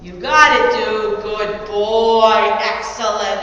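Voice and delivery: loud, happy praise for a good dog. Real, not acted. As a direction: let him know he nailed it. Praising dog after finding treat.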